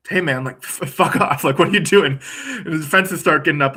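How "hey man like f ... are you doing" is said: defensive retort. Retold or imitated. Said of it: "And Will's like".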